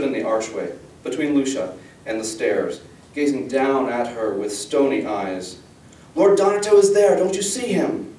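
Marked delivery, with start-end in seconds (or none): 6.1-8.1 high cry, loud at first; frantic pleading. A narrator's reading. Girl showing father the god blocking her way, frantic pleading. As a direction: insist in alarm, voice held high.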